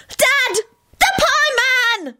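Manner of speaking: very high, drawn-out delighted shriek; frantic holler. A narrator's reading. Boy spotting the pieman, frantic and high. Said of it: "he hollered frantically".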